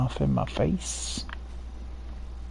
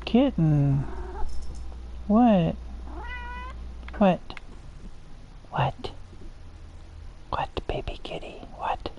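talking to a kitten; 2 semitones higher, 7 dB quieter than usual. Real, not acted. Cooing at a game kitten.

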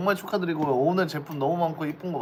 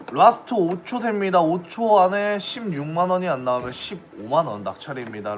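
loud last-call auction announcement; auctioneer; 2 semitones higher, 4 dB louder than usual. Acted. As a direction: call out the final seconds.

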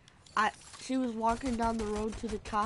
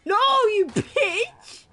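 loud high yell at an enemy; angry yell; 11 semitones higher, 9 dB louder, slower than usual. Real, not acted. Gamer yelling at an enemy player, furious. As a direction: scream it out in frustration.

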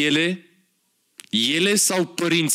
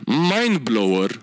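drawn-out name; 4 semitones lower, much slower than usual. Pretend. Savouring brand name.

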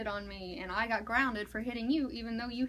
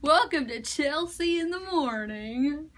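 louder, higher, slow mock host intro; morning show host; 4 semitones higher, 6 dB louder, slower than usual. Host opening a pretend morning show, hammed-up announcer. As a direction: put on a drawn-out show opening.

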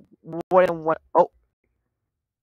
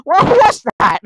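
high, loud shocked question; scared shriek of a question; startled cry; 9 semitones higher, 7 dB louder, slower than usual. Real, not acted. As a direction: blurt it out in real alarm. Second jump scare.

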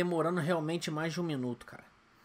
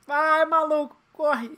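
frustrated shout at the screen, higher; yelling; 10 semitones higher, 11 dB louder, much slower than usual. Real, not acted. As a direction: yell at the slow runner. Yelling at slow character.

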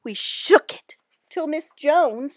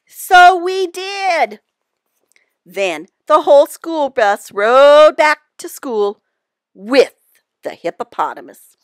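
ordinary delivery, nothing marked

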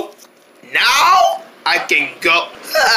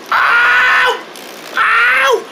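pained yelp; 14 semitones higher than usual. Acted. The character's head is hit and he yelps in pain.

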